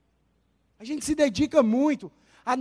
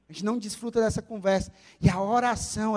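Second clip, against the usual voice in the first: ordinary talk, nothing marked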